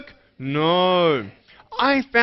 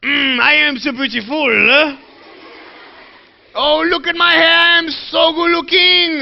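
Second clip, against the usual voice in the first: loud, hammed-up vanity, rising higher; mock self-admiration; 7 semitones higher, 7 dB louder than usual. Speaker mocking someone admiring themselves in the mirror, hammed-up vanity. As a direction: swoon loudly over your own looks. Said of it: "look at yourself and go".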